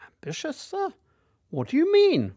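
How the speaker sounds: high, puzzled schoolboy; lightly voiced bewildered boy; younger self. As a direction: play your confused fifteen-year-old self. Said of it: "I said".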